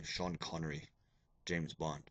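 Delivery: lightly attempted James Bond voice, low and soft; Sean Connery. Shown as a demonstration. Teacher showing students his terrible Bond impersonation, lightly done Connery impression. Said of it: "I do the old Sean Connery".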